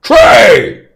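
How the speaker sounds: very high, loud giant shout; roaring giant. Acted. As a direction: bellow the demand. Slams trunk, demands trade.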